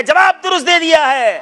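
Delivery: loud, high quiz-host cry; triumphant announcement. Real, not acted. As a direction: proclaim the correct answer to the crowd. Quiz host announcing correct answer.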